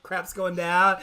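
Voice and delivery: normal talking voice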